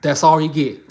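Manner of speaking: loud, teasing line, pointing out the look; hammed-up flirty tease; mock Southern flirt. Shown as a demonstration. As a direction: play a coy Southern woman brushing him off. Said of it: "this how a Southern woman flirts".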